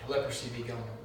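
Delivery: low, hushed mock incantation; solemn healer. Imagined man of God dismissing the leprosy, mock solemn. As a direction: intone it like a faith healer, very quietly.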